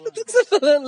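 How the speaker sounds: a stunned what-are-you-saying; incredulous. Retold or imitated. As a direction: author stunned by a fan's fierce letter, high and incredulous. Author baffled by fan.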